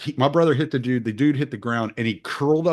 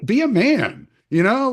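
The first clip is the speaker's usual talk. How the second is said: scornful rant; 9 semitones higher than usual. Retold or imitated. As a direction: sneer at him to get up and be a man. Retelling scolding the crying guy.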